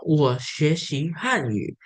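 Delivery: slow model Mandarin sentence; example sentence. Shown as a demonstration. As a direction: say it slowly for learners to follow. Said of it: "saya berikan contoh".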